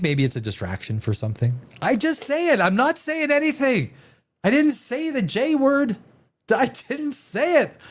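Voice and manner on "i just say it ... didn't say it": mock innocent. Coy denial, playing innocent.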